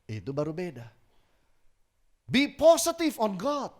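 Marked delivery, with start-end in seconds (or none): 2.3-3.7 high, loud, slow slogan; preaching shout. Real, not acted. Preacher urging the congregation, loud and high. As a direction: call it out like a rally cry.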